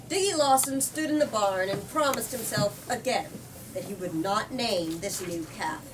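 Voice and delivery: ordinary delivery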